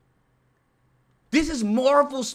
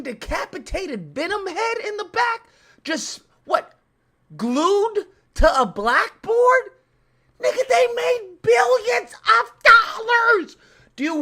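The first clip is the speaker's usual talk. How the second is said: high, slow furious yell of disbelief; outraged shout; 12 semitones higher, 6 dB louder, slower than usual. Real, not acted. Vlogger ranting to viewers about the studio's billions, furious shout. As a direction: bellow it at the viewers, stretching every word. Outraged rant at Comic-Con setup.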